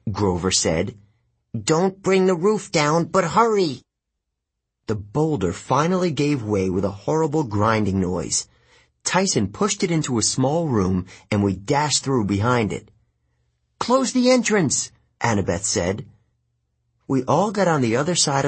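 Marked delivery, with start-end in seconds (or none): 1.7-3.8 high-pitched, pressing plea; anxious urging. Acted. Grover urging Tyson to shift the boulder, high and anxious. 13.8-14.9 very high, urgent command; urgent order. Acted. Annabeth to friends fleeing a monster, high urgent order.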